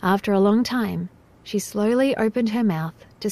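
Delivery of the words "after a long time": usual speaking voice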